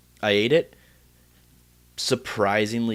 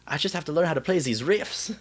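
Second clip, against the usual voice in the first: higher, playful Scandinavian accent imitation; 8 semitones higher than usual. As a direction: voice a Metalocalypse band member in a Scandinavian accent. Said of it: "how they're like".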